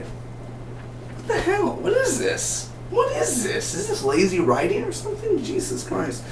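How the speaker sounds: very high, incredulous squeal; strained high-pitched mock outrage; exasperated. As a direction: squeak out your disbelief at lazy writing. Mock exasperation at lazy writing.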